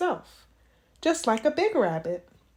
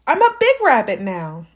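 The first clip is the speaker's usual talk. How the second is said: put-on loud, proud kid declaration; boy telling Dad he is big; proud child; 8 dB louder than usual. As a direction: puff up like a little boy. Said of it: "said Jasper".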